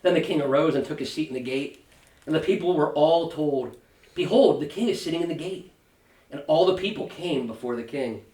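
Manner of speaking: measured, solemn Bible reading; scripture reading. Acted. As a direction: read the chapter aloud to the class, unhurried. Reads scripture passage aloud.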